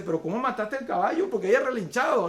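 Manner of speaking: más agudo y fuerte, incrédulo; reclamo indignado. Retold or imitated. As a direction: reclama horrorizado. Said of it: "el novio le reclama". (el novio le reclama a la novia, indignado)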